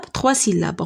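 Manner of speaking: usual speaking voice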